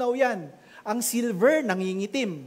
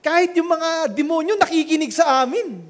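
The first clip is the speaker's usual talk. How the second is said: excited disciples; 5 semitones higher, 4 dB louder than usual. Retold or imitated. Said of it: "sabi nila".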